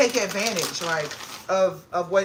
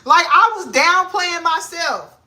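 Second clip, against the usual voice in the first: higher, louder and slow; excited admission; 9 semitones higher, 7 dB louder, slower than usual. Real, not acted. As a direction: stretch it out, amazed at yourself.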